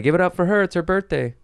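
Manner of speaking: high, bright MC hype for the crowd; mock host shout-out; hyped host voice. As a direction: oversell the birthday like a club host. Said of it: "Be like".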